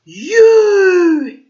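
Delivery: high, loud bellow. A narrator's reading. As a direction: bellow the word at him. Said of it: "he suddenly bellowed".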